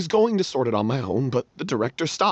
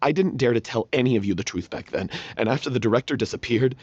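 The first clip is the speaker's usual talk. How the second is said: ordinary talk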